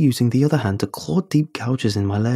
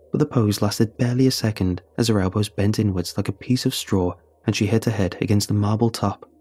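usual speaking voice

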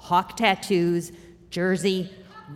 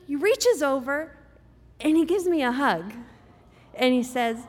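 ordinary talk

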